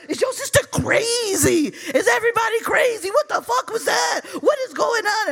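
high-pitched, frantic disbelief; mock outraged boyfriend. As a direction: fire off appalled questions, voice way up. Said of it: "his face contorted".

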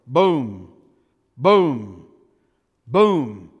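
three loud, low, falling booms. Acted. Preacher marking the rooster's crows with loud booms. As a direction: strike each boom like a drum. Rooster crowing beats dramatized.

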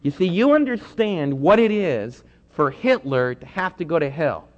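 ordinary delivery

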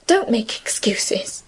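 slow, breathy whisper, barely voiced. A narrator's reading. Emily to the apologising Francine, whispering. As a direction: let the words fall away, spent from crying. Tearful girl dismisses an apology.

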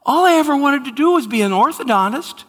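hammed-up eager confession; mock testimony. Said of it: "This is the one I've heard".

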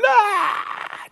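sudden high, loud bawl; mock crying shriek. Daughter asked about the missed word, mock crying shriek. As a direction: burst into a cartoonish wail.

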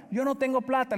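daughter's protest. Retold or imitated. Daughter answers about paying.

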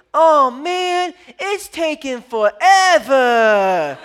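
put-on high, loud kid complaint, hugely drawn out; imitated daughter's whine. Dad mimicking daughter on a long drive, loud whiny wail. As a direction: mimic a child's exasperated wail, last word stretched. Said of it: "I've mimicked exactly what it sounds like".

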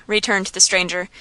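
ordinary delivery

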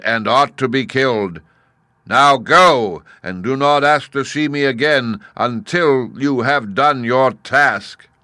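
imperious giant, weighing every word; stern Great Head. A narrator's reading. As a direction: boom out a final command.

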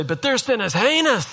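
high-pitched, indignant objection; mock protest. Preacher voicing a spouse blaming the other's sin, mock indignant. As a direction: imitate an aggrieved spouse, pitch soaring. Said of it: "And you say".

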